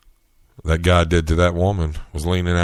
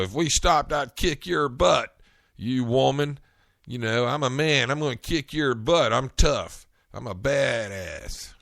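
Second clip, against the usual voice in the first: mock macho man; 7 semitones higher than usual. Mocking the macho driver.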